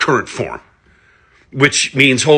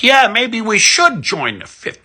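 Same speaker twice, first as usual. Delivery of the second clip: mocking liberals; 12 semitones higher than usual. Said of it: "who are like".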